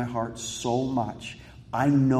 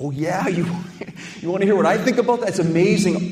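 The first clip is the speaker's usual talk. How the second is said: fast, higher, louder sarcastic retort; mock comeback; 8 semitones higher, 7 dB louder than usual. Firing back at the texter, mock indignant and fast. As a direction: rattle it off like you're itching to fight back. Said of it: "immediately I was like".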